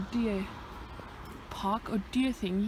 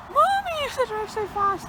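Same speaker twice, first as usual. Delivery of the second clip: high-pitched mock whiny cry to Mommy; put-on little-kid voice, pitched way up; imitated passengers; 12 semitones higher, 3 dB louder than usual. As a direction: squeal it like a complaining kid. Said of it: "they're like".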